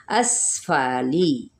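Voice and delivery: अस्फाली slow with a hissing स; tajweed demo; whistled sin. Shown as a demonstration. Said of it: "ये सीन पे सीटी".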